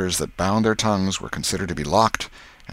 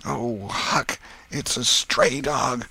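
high, thin-voiced dread, drawn out; fearful hushed whisper; 7 semitones higher, much slower than usual. A narrator's reading. Tom peeks out and tells Huck, hushed dread. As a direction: break the dreadful news slowly, half-whispered. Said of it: "His whisper was hardly audible".

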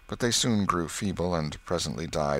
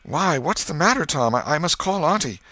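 put-on high, loud, worried boy; raised voice in sudden alarm; 10 semitones higher, 6 dB louder than usual. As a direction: fret urgently over a suffering brother. Sid alarmed by brother's groans.

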